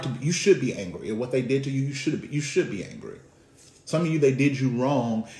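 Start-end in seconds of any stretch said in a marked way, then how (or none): none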